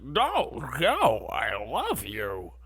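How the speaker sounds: mock pleading lover. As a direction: overplay the clingy leading man. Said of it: "And he's like".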